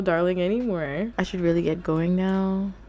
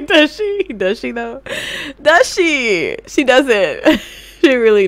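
loud, mocking does she; scoffing at the screen; teasing disbelief; 5 semitones higher, 10 dB louder than usual. Real, not acted. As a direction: needle her with it, louder and higher. Teasing the game character.

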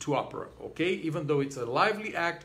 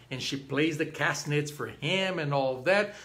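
ordinary talk, nothing marked

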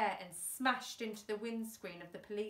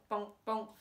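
plain reading voice

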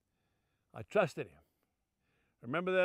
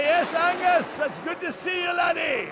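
higher, hearty Scottish father's greeting; raised, lively Scottish accent; 7 semitones higher, 4 dB louder than usual. Retold or imitated. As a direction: greet your boy in a broad Scottish accent. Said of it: "in that Scottish accent".

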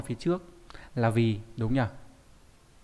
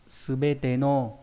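very slow model word; 4 dB louder, much slower than usual. Shown as a demonstration. Teacher saying subete no very slowly for students. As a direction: say subete no very slowly, each syllable apart. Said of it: "Nó bằng".

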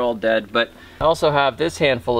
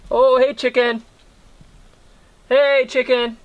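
calling a chicken; 9 semitones higher than usual. Real, not acted. Greeting the chickens.

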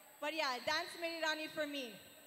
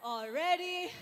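out of breath; 2 semitones higher, 4 dB louder, slower than usual. Real, not acted. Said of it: "I'm, I'm out of breath".

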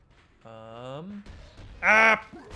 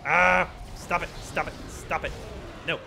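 panicked shouting; 4 semitones higher than usual. Real, not acted. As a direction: yell at the attacker to stop. Being attacked in game.

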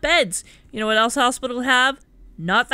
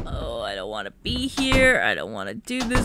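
reluctant whine; 14 semitones lower than usual. Acted. Player dreading the horror game, reluctant whine.

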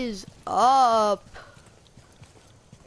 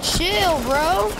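frat bro; 6 semitones higher, 4 dB louder than usual. Real, not acted. Attacked again.

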